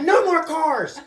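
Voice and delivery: high, very loud scolding yell; angry shout. Retold or imitated. Dad hit by toy car scolds son, angry shout. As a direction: yell at the kid at full volume.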